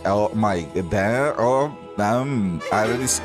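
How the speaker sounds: normal talking voice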